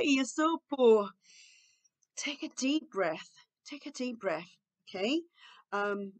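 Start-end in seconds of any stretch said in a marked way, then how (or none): 2.1-4.5 slow, hushed guidance; calming instruction. Shown as a demonstration. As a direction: soothe a child softly and slowly. Models calming replacement phrase. (orientação calma e suave)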